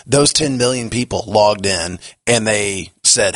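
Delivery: normal talking voice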